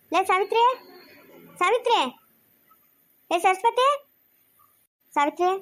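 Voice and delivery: high, rising calls to someone away; calling out. Acted. Calling Savitri and Saraswati to come, high rising call. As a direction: summon them by name, pitch lifting. Calling household women by name.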